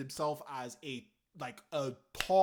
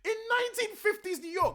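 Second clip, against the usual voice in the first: incredulous outburst; 9 semitones higher, 4 dB louder than usual. Real, not acted. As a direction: pitch jumps way up in disbelief. Rant about the missing accent.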